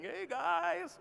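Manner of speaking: high, breezy Hey guys; chirpy influencer greeting. Retold or imitated. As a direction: play the cheery beach influencer. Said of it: "me at the beach saying".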